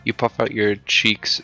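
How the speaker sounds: ordinary talk, nothing marked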